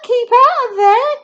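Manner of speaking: high, loud urgent warning; boy warning Grandma off the attic; warning call. Acted. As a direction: shout up urgently. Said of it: "George called out".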